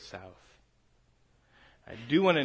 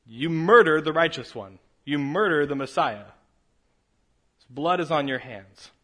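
fierce rebuke; 5 semitones higher, 10 dB louder than usual. Acted. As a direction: thunder it at the council. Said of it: "finishes this and says".

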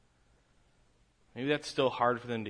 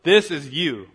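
confronting the crowd; 4 semitones higher, 10 dB louder than usual. Acted. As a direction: point at them and say it hard. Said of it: "turns and says".

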